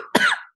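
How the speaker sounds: sudden gagging cough; choking cough after gagging; coughing fit. Real, not acted. Deep throat trial gag.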